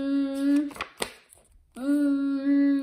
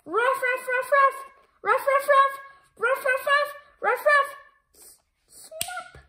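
dog barking; 10 semitones higher than usual. Acted. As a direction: bark like an excited dog. Dogman barking through scenes.

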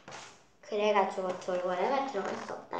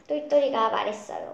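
ordinary delivery, nothing marked

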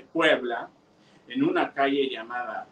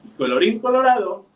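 storybook ending; 7 semitones higher, 6 dB louder, faster than usual. A narrator's reading. Storybook closing formula.

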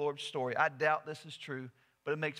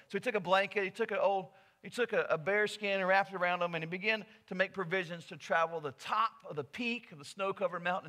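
ordinary talk, nothing marked

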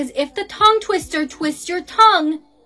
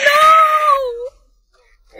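long high cry of no; anguished shout; 10 semitones higher, 4 dB louder than usual. Acted. Girl losing her friend to the sound, anguished shout. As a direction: wail it out in dramatic despair.

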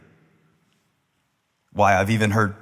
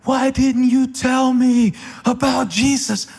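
high, slow, anguished mock scream; screaming from hell; 5 dB louder, slower than usual. Youth skit girl calls from hell to friends, hammed-up anguished scream. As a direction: wail it like a girl crying out from hell. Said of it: "she was screaming from hell".

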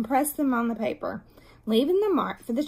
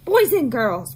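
loud teacher's call to the class; firm, projected classroom call; raised voice; 8 dB louder than usual. A narrator's reading. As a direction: call the room to attention loudly. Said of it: "Miss Robbins raised her voice".

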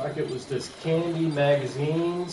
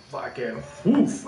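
slow drawn-out woof; much slower than usual. Retold or imitated. Retold reaction, drawn out.

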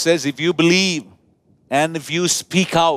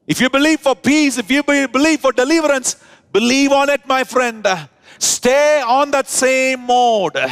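full-force preaching; 5 semitones higher than usual. Real, not acted. Preacher exhorting the church to hold faith, high forceful preaching. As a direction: lift it up and drive it home.

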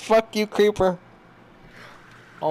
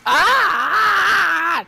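sudden much higher, louder scream; startled scream; 13 semitones higher, 7 dB louder than usual. Real, not acted. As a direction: yelp in fright at a mob. Skeleton attacks in the cave.

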